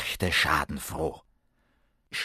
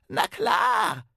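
goblin voice; 9 semitones higher than usual. Acted. The Kobold answers Ben.

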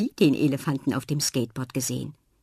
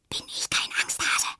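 whisper. A narrator's reading. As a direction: whisper it bravely. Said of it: "flüstert sie". (flüsternd)

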